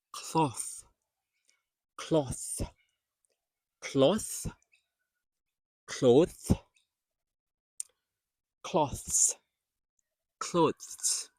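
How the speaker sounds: each word alone, "cloth, cloths, clothes" with a clear final th; TH at word end. Shown as a demonstration. Teacher showing students final TH, careful articulation. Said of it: "this is the T H at the back of the word".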